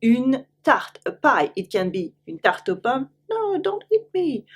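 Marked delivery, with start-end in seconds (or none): 3.3-4.4 high squeaky little voice begging; pleading fruit. Pretend. Teacher voicing a pie apple pleading not to be eaten. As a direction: play a tiny apple begging for its life.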